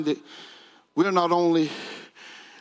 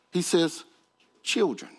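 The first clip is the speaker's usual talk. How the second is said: ordinary delivery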